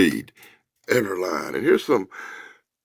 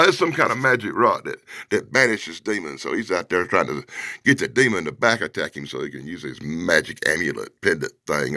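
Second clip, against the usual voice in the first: ordinary talk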